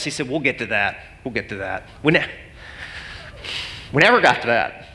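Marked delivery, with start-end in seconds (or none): 0.3-1.8 softer, lower fading reassurance; calm dismissal. Retold or imitated. Benefactor deflecting about bookshelves, soft and low. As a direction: brush it off softly.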